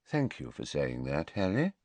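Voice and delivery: low, soft thanks; quiet. A narrator's reading. Headmaster thanks pupil for apologising, quietly. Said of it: "said Dumbledore quietly".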